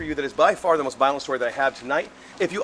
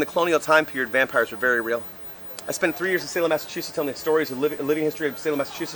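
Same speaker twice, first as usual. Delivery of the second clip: ordinary talk, nothing marked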